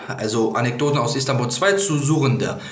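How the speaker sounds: normal talking voice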